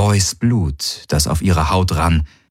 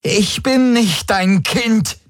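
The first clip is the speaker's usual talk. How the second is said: slow, high-pitched, hurt defiance; pained, emphatic refusal; angry rejection; slower than usual. A narrator's reading. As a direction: push the old woman away with words. Ylana angrily rejects Voi.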